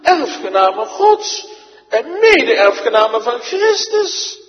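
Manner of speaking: loud, high, ringing pulpit declamation; full-force preaching. Acted. Preacher thunders the Bible text to his congregation, full force. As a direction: proclaim it to the whole church.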